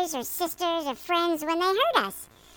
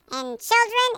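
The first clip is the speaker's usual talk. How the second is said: usual speaking voice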